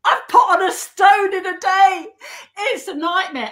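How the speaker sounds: loud, high, drawn-out mock wail; apoplectic. Guest retelling a shock on the scales, apoplectic. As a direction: cry out in hammed-up horror. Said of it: "it sent me into apoplexy".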